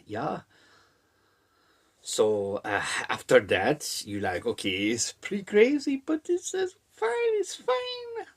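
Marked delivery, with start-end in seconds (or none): 4.4-8.3 climbing into very high falsetto; mock self-reassurance. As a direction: squeak higher with each reassurance. Voices a reader reassuring self.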